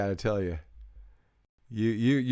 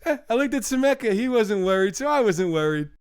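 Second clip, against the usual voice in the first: lightly voiced high, fast casual shrug; Abatanose impression; 9 semitones higher, 3 dB louder than usual. Retelling the friend's funny line, higher and breezier. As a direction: toss it off like an unbothered buddy, pitched up. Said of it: "And Abatanose goes".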